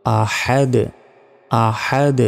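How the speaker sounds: "আহাদ" with strong throat h and bouncing d; emphatic Arabic "a-Had-e"; big haa. Shown as a demonstration. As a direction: push the h of "আহাদ" from mid-throat, release the d. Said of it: "বড় হার উচ্চারণ করতে হবে".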